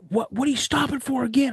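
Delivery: high, sharp mock exasperation; incredulous instructor; exasperated instructor. Instructor demanding why the recruit stopped again, exasperated. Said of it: "and he's like".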